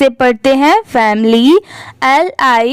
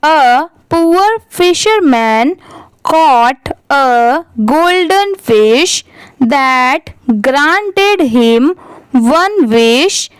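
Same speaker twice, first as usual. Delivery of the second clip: very slow, pausing between phrases; slow deliberate read-aloud; much slower than usual. Shown as a demonstration. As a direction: read each phrase slowly to the class. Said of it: "अच्छे से read करके देखते".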